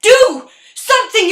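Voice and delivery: high, loud goading; urgent shouting. Acted. Sasha goading him to smash things, loud urgent shout. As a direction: egg him on at full voice.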